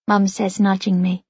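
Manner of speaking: ordinary delivery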